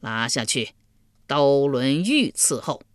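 stern order. Acted. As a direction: hand down the sentence coldly. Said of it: "阎罗天子喝道".

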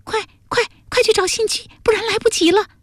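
put-on very high, breathless-sounding girl in a panic; urgent. Jialuo urges friends to find Xinju, urgent and high. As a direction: plead for haste in a high, small voice. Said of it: "伽罗急道".

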